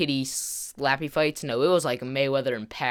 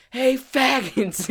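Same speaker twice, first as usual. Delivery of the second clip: high, slow, stretched-out jeer; taunting call-out; 9 semitones higher, much slower than usual. Retold or imitated. Friend repeats his taunt to rivals, imitated call-out. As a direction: imitate a friend provocatively calling out.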